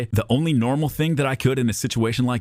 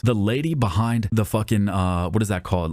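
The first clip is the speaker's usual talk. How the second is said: normal talking voice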